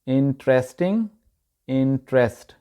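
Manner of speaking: इंटरेस्टिंग and इंटरेस्ट with an 'e' in 'trest'; correct pronunciation. Shown as a demonstration. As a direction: say इंटरेस्टिंग as IN-tres-ting, इंटरेस्ट as IN-trest. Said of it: "इसकी सही तलफ़ुज़ है".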